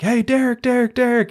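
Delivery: put-on high-pitched girl calling a name. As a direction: call the name up high from a four-wheeler. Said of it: "they're like".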